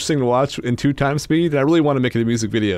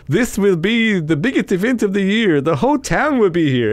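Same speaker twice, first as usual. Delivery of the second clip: higher-pitched mock German accent; 6 semitones higher than usual. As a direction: imitate a movie scientist with a German accent. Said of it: "he with his German accent".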